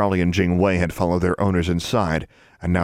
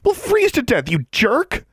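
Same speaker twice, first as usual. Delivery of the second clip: high, loud angry protest; angry cry; 4 dB louder than usual. A narrator's reading. Alexandra protests at Larry, angry. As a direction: hurl it at him.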